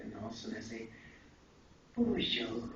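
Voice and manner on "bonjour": lightly put-on French-Canadian Bonjour; French greeting in his accent. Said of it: "try to do your accent again".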